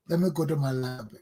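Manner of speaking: ordinary talk, nothing marked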